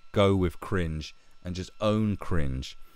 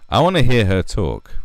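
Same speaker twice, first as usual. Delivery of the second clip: loud demand; 5 semitones higher, 11 dB louder than usual. Real, not acted. Repeats demand louder.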